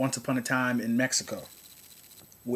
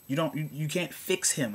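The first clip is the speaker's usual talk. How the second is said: usual speaking voice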